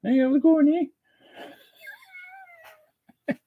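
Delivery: very high falsetto Canadian pet voice; tiny high hoser critter voice; Lucy. Pretend. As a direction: squeak a greeting as the little pet. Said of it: "Lucy's here to say hi".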